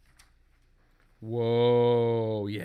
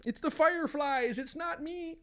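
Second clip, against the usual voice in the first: high desperate husband voice; 13 semitones higher, 3 dB quieter than usual. Acted.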